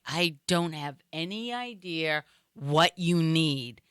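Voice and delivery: slow, drawn-out bafflement, word by word; baffled customer. Retold or imitated. Florida customer baffled by the Bostonian's request, slowly baffled. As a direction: spell out your confusion very slowly.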